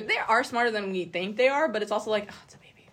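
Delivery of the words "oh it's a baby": barely voiced, very soft aside; hushed little whisper; whispered coo. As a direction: whisper it softly, as if over a baby. Cooing aside about a baby.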